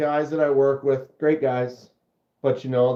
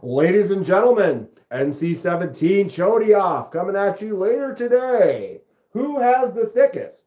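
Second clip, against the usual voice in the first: mock announcer; 4 semitones higher than usual.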